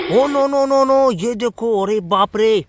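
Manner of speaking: high, loud alarmed oh no. Acted. Narrator to kids spotting the crash, high loud alarm. Toy car crash discovered.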